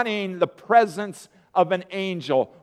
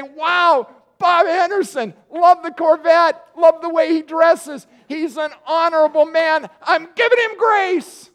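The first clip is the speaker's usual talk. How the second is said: very high, loud, gushing admiration; hammed-up fanboy God; mock gushing; 11 semitones higher, 6 dB louder than usual. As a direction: gush like a starstruck fan. Mocking God as a fan.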